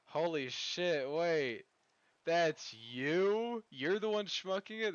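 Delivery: sudden loud, high astonishment; loud surprise. Real, not acted. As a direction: gasp it out, caught off guard. Streamer recognizes a chatter.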